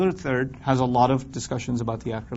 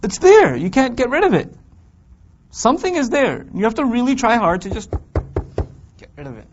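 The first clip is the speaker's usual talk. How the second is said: high, loud insistent inner voice; nagging inner voice; 8 semitones higher, 5 dB louder than usual. Acted. Teacher dramatizing the inner voice, high and loud. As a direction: let the doubt ring out high.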